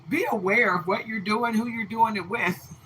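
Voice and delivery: laughing out loud while talking; nervous laugh. Real, not acted. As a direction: bursts into an uneasy laugh. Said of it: "more of a nervous laugh".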